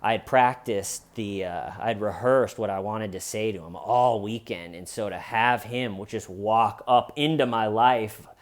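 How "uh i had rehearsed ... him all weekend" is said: normal talking voice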